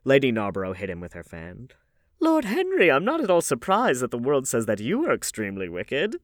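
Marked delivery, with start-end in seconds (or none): 2.2-6.1 high, loud rebuke, starting at a peak; hostess's raised-voice reproof; playful scolding. A narrator's reading. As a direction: tick off a naughty guest with relish. Hostess playfully scolding Lord Henry.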